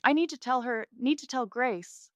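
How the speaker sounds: high, loud desperate insistence; crying out. A narrator's reading. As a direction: plead urgently while delirious. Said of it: "Mom cries out".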